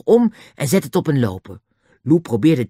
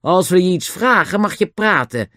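raised, stern reprimand; sharp; 7 semitones higher, 4 dB louder than usual. A narrator's reading. The inspector lays down the rules, sharply. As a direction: put the prisoner firmly in his place. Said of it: "zei de voorste agent scherp".

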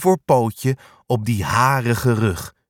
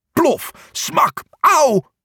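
high, mock-pained landing sounds; thud and ouch; much slower than usual. A narrator's reading. Angsthaas lands hard outside, high mock yelp. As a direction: act out the fall, ending in an ouch.

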